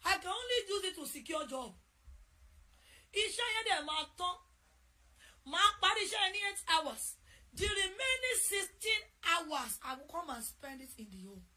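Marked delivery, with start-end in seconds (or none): 3.1-9.8 rising preaching. Real, not acted. Preaching build.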